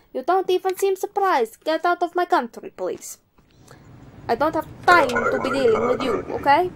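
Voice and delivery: put-on border inspector slipping into Italian accent; put-on Italian accent, animated refusal; accidental Italian accent. Inspector turns away an entrant with expired papers, hammed-up Italian accent. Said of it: "I don't know why I got Italian".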